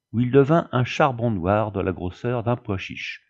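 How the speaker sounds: usual speaking voice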